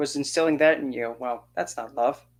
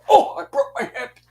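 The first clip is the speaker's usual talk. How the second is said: put-on much higher, louder squeaky old man; feeble old man whine; 11 semitones higher, 5 dB louder than usual. Mocking the pushed-over old man, a high whiny voice. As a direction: squeak it out like a frail geezer. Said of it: "he'll probably go".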